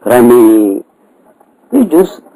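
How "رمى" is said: رمي with its final aa bent toward "ee"; long e-like ending on رمي in place of aa; imala on the alif. Shown as a demonstration. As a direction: recite رمي as "ramee", tilting the last vowel. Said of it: "يجوز أن تميله".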